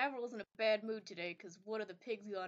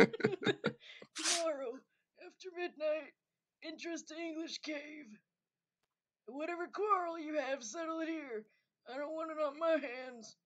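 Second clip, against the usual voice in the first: higher, slow lightly voiced old man; a hint of an old man; 6 semitones higher, slower than usual. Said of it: "I'm gonna try for an old man voice".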